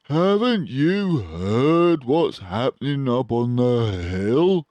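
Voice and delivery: excited. A narrator's reading. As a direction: burst in with alarming news.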